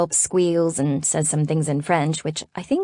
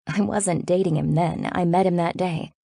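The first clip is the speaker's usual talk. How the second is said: usual speaking voice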